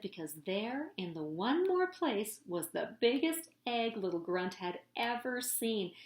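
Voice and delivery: plain reading voice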